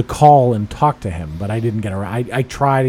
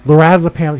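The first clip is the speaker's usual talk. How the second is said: mock announcement, raised and louder; retold yelling; 3 semitones higher, 6 dB louder than usual. Pharmacist announcing a customer's drug to the whole store, mock yell. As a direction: call it out as if over a counter. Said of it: "starts yelling over the counter".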